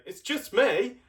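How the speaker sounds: high, small goat voice; timid little goat. Acted. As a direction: squeak it like a tiny billy goat. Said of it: "They say".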